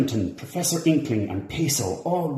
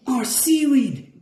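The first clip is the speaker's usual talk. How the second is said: high, slow shocked gasp; 4 dB louder, slower than usual. A narrator's reading. Peso finding the seaweed stolen, high shocked gasp. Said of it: "gasped Peso".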